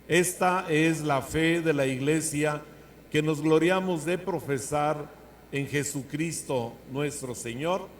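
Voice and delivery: slow solemn liturgical proclamation; cathedral echo. Acted. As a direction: intone it solemnly and slowly. Liturgical formula after creed.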